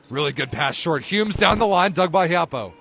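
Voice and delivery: live play-by-play, getting hyped; excited rally call. Real, not acted. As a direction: keep up with a fast rally.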